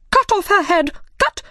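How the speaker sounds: high, loud royal command; furious shout. Acted. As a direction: scream the order in a rage. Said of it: "She looked at Alice and shouted".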